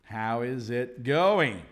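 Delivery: "how is it going" said slowly, each word apart; slow full form. Shown as a demonstration. Teacher spelling out a greeting for learners, slow and careful. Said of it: "which is the same as".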